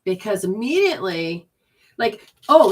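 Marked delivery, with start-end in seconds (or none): none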